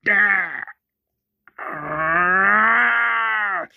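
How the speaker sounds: acting out being strangled, half-crying; choking cry. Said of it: "John Dory's dad is like".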